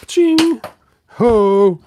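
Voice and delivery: high, loud toy clang and whoop; mock metal ching then a high whoop; ching sound effect. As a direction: sound off the buzzsaw like a cartoon.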